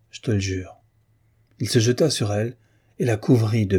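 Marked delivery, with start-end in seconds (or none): 0.1-0.8 weary sigh. A narrator's reading. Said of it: "avec un soupir de lassitude mortelle".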